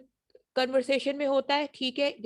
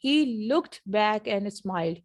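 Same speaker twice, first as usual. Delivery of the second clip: slow "he looked back and smiled", word by word; careful reading style; much slower than usual. Shown as a demonstration. Teacher imitating how learners read, slow and careful. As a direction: read each word out in full, unhurried. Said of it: "अगर ऐसे पढ़ें जैसे आप पढ़ रहे हैं".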